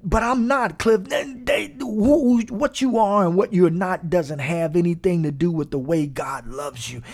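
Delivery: usual speaking voice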